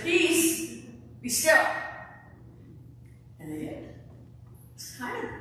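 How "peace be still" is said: high and loud "Peace, be still" as a firm order; forceful command "Peace, be still". Shown as a demonstration. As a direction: order the wind and waves to shut up. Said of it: "He said".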